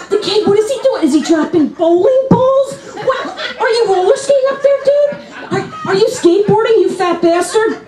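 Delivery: very high, incredulous questioning; comic high-pitched outrage; exasperated rant. Acted. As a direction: fire baffled questions at the noisy kid upstairs. Yelling up at noisy neighbours.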